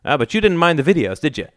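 high, quick accusing challenge; pointed, heated question; demanding. A narrator's reading. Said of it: "demanded his father".

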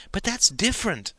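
high insistent protest. Acted. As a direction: push back, voice jumping up. Girl insisting, pitch jumps up.

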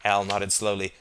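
ordinary delivery, nothing marked

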